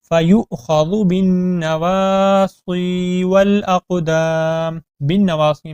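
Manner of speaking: slow melodic tajweed chant; Quran recitation. Shown as a demonstration.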